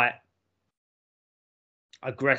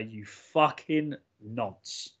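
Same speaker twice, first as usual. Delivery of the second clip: slow, deliberate, softer jab; drawn-out, low-pitched contempt; needling insult; 6 semitones lower, 8 dB quieter, much slower than usual. Shown as a demonstration. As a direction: spell out the insult word by word to get under their skin. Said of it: "you really wanna get under someone's skin".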